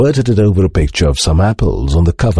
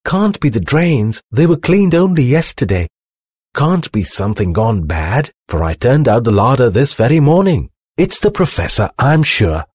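lightly voiced housekeeper, higher pitch; fretting housekeeper; 6 semitones higher than usual. A narrator's reading. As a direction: puzzle it out as a mildly fretful housekeeper.

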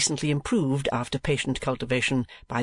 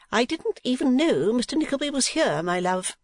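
high, affected voice of a wife; high-voiced wife; 11 semitones higher than usual. A narrator's reading. Wife excusing herself to her husband, high-pitched wife voice. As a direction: put on a much higher voice for the wife.

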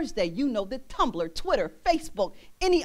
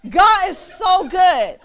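slow, loud and higher; exclaiming; 6 semitones higher, 11 dB louder, much slower than usual. Acted. Preacher proclaiming God's goodness. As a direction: stretch out each word in praise.

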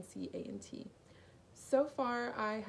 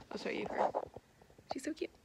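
whisper; 5 semitones higher than usual. Real, not acted. Whispering beside her bunny.

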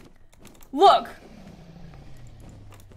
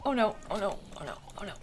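hushed dread; 9 semitones lower, 13 dB quieter, much faster than usual. Real, not acted. Trouble in hole.

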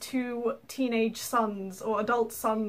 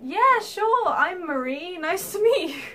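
higher, louder chirpy agreement; bright, obliging hello; polite play-along; 7 semitones higher, 5 dB louder than usual. Retold or imitated. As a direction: play along to spare him. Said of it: "So I'm just like".

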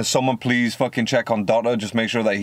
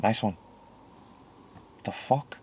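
dismissive mutter; 6 dB quieter than usual. Real, not acted. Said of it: "dismissive after mocking".

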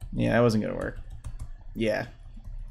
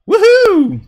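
high, loud woohoo; excited whoop; 9 dB louder than usual. Real, not acted. Player cheering a move in a match, excited whoop. Calling out during live gameplay.

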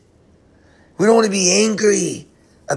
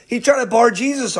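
normal talking voice

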